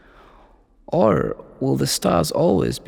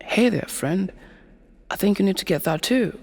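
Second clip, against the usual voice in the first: higher, lightly acted inner voice; coaxing brain; 7 semitones higher than usual. Brain talking to its owner after seeing friends' stuff, lightly coaxing. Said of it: "So your brain starts telling you".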